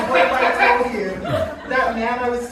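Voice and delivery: normal talking voice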